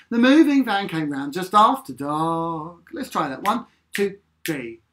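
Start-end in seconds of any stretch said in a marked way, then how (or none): none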